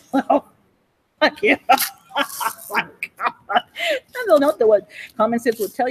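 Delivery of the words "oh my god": ordinary talk, nothing marked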